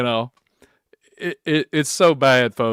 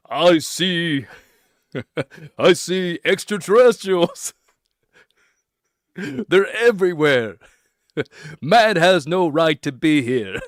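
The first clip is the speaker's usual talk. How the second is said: hammed-up alien prophet, breaking into laughs; lightly voiced doomsayer cracking up; mock prophet, laughing; 2 semitones higher, slower than usual. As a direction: preach it like a crank, giggling between lines. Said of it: "I could go to a pond and say".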